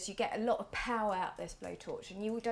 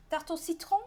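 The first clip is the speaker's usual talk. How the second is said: lightly attempted French accent, pitched higher; awful French accent; 8 semitones higher, 4 dB louder than usual. Shown as a demonstration. Baker quoting the French name for the tart, bad French accent. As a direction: have a clumsy go at French pronunciation. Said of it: "that's an awful accent".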